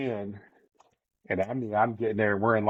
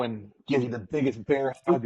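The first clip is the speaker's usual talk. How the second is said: usual speaking voice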